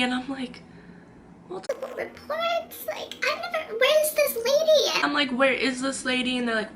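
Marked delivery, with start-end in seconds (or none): 1.5-5.0 squeaky disbelief. Retold or imitated. Questioning cops' accusation, high incredulous squeak. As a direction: act incredulous in a tiny high voice.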